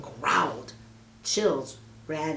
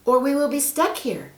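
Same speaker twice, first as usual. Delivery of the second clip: put-on higher, louder hopeful girl; girl Mary; 8 semitones higher, 5 dB louder than usual. Mary asks the snake for help, eager. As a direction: ask sweetly and eagerly.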